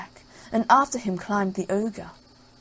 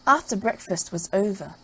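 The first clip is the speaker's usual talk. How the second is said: ordinary delivery, nothing marked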